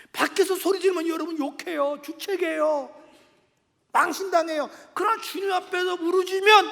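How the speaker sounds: impassioned preacher exhorting the congregation; fervent preaching. Real, not acted. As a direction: press harder toward the last phrase. Preaches at full force.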